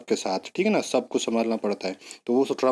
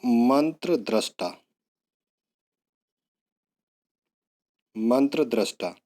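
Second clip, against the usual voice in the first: slow dictation; 3 semitones lower, slower than usual. Shown as a demonstration. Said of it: "मैं बोल भी साथ के साथ रहा हूँ".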